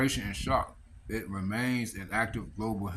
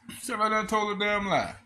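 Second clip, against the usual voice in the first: shouted accusation; 11 semitones higher than usual. Retold or imitated. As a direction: yell it out in disbelief. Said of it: "angry repeat, high loud".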